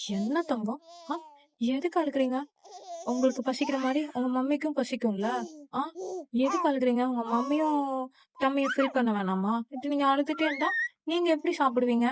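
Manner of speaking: playful soothing coo with rising swoops; cooing to baby. Acted. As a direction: gently coax a crying baby to stop.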